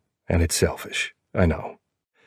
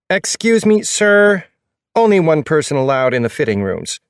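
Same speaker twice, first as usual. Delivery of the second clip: high-pitched, nasal, grating shop assistant; pinched nasal voice, raised up high; high nasal saleswoman; 10 semitones higher, 6 dB louder than usual. A narrator's reading. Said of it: "her high-pitched, nasally voice".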